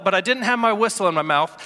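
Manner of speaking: ordinary delivery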